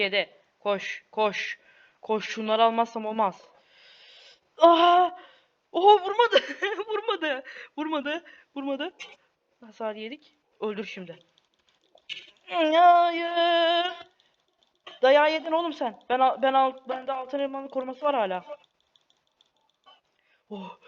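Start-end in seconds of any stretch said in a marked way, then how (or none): 4.6-8.9 high laughing disbelief; surprised. Real, not acted. Hit not landing, laughing. 12.5-16.0 being chased. Real, not acted.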